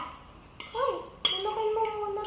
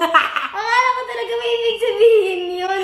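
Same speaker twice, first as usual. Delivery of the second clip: loud, laughing hard; 6 semitones higher, 11 dB louder than usual. Real, not acted. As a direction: blurt it out through laughter. Laughing at the counting joke.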